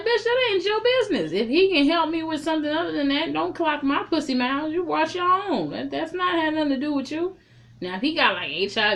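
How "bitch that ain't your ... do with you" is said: high, loud, fired-up comeback; fiery clapback. Pretend. Telling Lytris to mind her business, loud high clapback. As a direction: let her have it, shrill with outrage. Speaker's imagined retort to Lytris.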